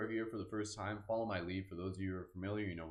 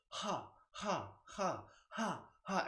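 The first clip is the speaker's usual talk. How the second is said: higher breathy ha breaths from the diaphragm; pulsed ha exhales; 9 semitones higher, much slower than usual. Shown as a demonstration. Teacher demonstrating the exhale to the group, higher pulsed ha breaths. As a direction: demonstrate again, belly-driven. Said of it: "So it's gonna go".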